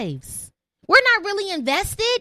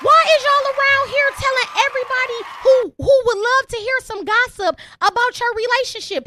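exasperated; 8 semitones higher than usual. Real, not acted. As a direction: call them out in disbelief. Exasperated rant at listeners.

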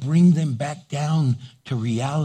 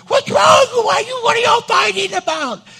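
much higher, loud alarmed call; alarmed wife; 6 dB louder than usual. Retold or imitated. Wife bursting into the loud den, high alarmed shout. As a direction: burst in worried about a fight. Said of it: "My wife sometimes will come in".

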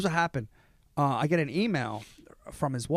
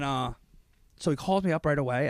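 normal talking voice

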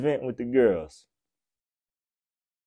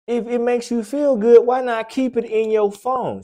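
exasperated repeat; 9 semitones higher, 4 dB louder than usual. Real, not acted. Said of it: "repeats the guy's question, louder".

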